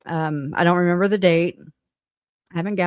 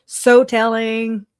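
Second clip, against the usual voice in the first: "So telling" stretched slow, higher and louder; drawn-out emphasis; 4 semitones higher, 5 dB louder, much slower than usual. Real, not acted. Reacting to the chatbot's answer, drawn-out emphasis. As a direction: draw out "So telling" for weight.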